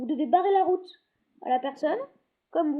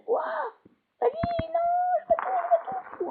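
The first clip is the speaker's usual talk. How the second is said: frantic. Real, not acted. Frantic moment in game.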